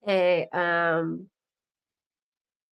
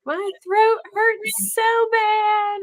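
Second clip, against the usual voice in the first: high, very slow, whiny imitation of a sick boy; mock whine; 13 semitones higher, much slower than usual. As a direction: drag it out as overdramatic. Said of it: "Just being so dramatic".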